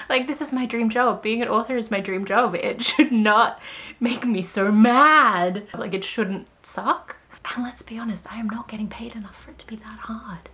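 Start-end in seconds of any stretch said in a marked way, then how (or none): 2.5-5.7 slow and loud, word by word; slow frustrated emphasis. Real, not acted. 7.5-10.5 whispered aside. Real, not acted. Confiding aside.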